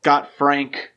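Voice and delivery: ordinary talk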